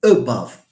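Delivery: above with a quick schwa and the stress on bove; model pronunciation. Shown as a demonstration. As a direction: model above with a light first syllable. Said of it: "It reduces to a shva".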